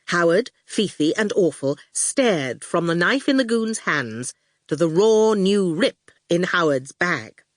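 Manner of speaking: ordinary delivery, nothing marked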